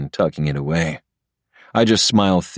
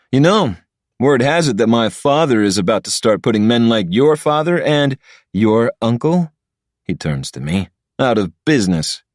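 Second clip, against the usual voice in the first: lightly voiced arrogant rival, higher; smug rich boy; 5 semitones higher, 3 dB louder than usual. Patrick gloating at Ilya, a hint of smug rival. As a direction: gloat about your powerful father.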